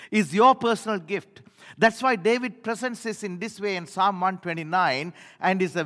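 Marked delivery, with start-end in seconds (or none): 0.1-1.4 repeated louder and higher; now proclaim it out loud; loud declaration. Real, not acted. Preacher booming the good news back to the church.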